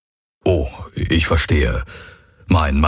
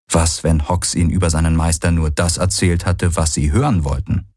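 ordinary delivery

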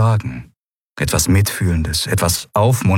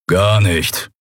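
grumbling, resigned reply; grumbled. A narrator's reading. Keru crushing the boy's hope, grumbled. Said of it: "brummte Keru".

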